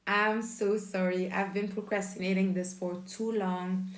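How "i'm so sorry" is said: usual speaking voice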